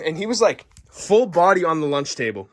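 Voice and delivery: usual speaking voice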